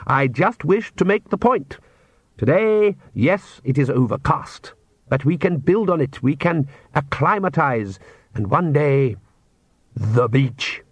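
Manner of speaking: measured, mildly cheerful lecturing count; optimistic count. Acted. Count coaxing his family into daylight, cheerfully measured. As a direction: set out the plan grandly and patiently.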